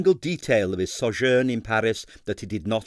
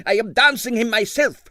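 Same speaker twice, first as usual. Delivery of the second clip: put-on fiercely declared, high and loud with a Russian accent; 13 semitones higher, 7 dB louder than usual. A narrator's reading. Impresario on the phone, fiercely, in a Russian accent. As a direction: insist on it fiercely. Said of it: "he finished fiercely".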